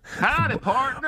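high-pitched hammed-up Texas greeting; fake Dallas accent. Shown as a demonstration. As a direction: put on a silly Dallas cowboy accent. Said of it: "trying to sound like you're".

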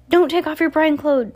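high, loud frantic plea; frantic cry. A narrator's reading. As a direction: cry it out in panic. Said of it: "cried Olaf".

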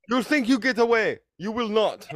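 a playful Russian taunt; mock Russian threat. Imagined Russian girl taunting him in a mock Russian accent. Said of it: "like, fucking".

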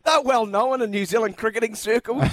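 high, mocking pretend reply. Host inventing the guest's answer to Kevin Rudd. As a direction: keep the silly high voice going.

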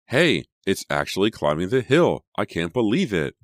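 high, loud, delighted exclamation; thrilled outburst with swooping pitch; amazed excitement. A narrator's reading. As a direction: exclaim in happy disbelief. Car makes it up the hill.

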